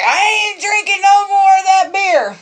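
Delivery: husband swearing off beer, loud mock outrage; husband's vow. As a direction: ham up his outraged vow. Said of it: "He was like".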